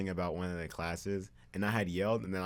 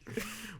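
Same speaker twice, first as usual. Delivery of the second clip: high burst of laughter; 14 semitones higher, 6 dB quieter than usual. Real, not acted. Reacts to bee teasing.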